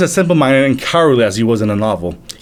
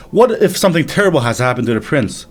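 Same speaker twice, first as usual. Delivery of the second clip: ordinary delivery, nothing marked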